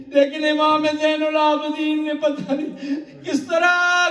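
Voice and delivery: loud, high, wailing sermon climax with sobs in the voice; impassioned majlis preaching. Acted. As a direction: cry it out to the mourners, building grief. Majlis climax, preacher at full force. (پرجوش گریہ آمیز مجلس خطابت)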